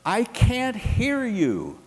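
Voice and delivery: student shouting to Groucho from the crowd, high mock yell; kid yelling out. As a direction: call it out from the back of a crowd. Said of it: "some kid yelling out".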